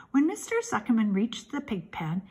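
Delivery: plain reading voice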